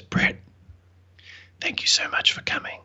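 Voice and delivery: soft whisper of a dying woman. Retold or imitated. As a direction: breathe the thanks out in a whisper, barely there. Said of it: "she whispered".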